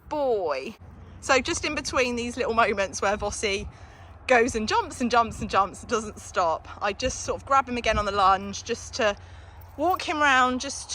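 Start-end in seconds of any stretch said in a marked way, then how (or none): none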